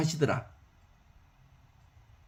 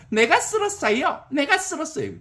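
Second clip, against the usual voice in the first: eager brooms; 9 semitones higher than usual. Acted. Brooms claim credit.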